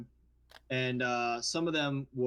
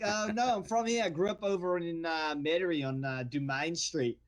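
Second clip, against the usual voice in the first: put-on Australian accent, pitched up at first; 4 semitones higher than usual. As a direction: play an Australian bartender claiming to be local. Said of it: "work an entire shift as an Australian".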